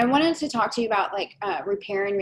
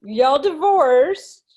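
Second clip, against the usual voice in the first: incredulous; 7 semitones higher, 6 dB louder, slower than usual. Real, not acted. Host's incredulous recap of the remarriage.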